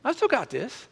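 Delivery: cocky younger self. Retold or imitated. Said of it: "I'm going".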